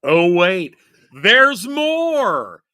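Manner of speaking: loud, booming infomercial announcer. Shown as a demonstration. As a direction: hype it like a TV pitchman. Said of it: "that's my announcer voice".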